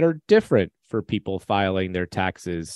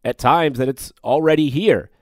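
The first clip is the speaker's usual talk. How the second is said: ad read; 3 semitones higher than usual. Acted. Host reads sponsor ad.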